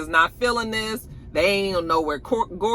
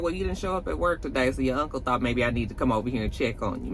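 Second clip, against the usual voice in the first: quick explanation; 5 semitones lower, 6 dB quieter, faster than usual. Retold or imitated. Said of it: "she was just like".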